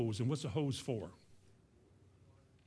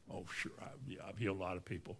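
mock false modesty; 7 semitones lower, 11 dB quieter, much faster than usual. Boastful healer feigns modesty.

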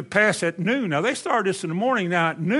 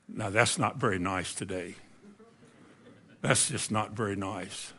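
low, soft mock disapproval; understated tut-tutting aside, much lower; hushed aside; 9 semitones lower, 11 dB quieter than usual. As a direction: drop to a quiet, deadpan scolding. Dry aside after the mocking shout.

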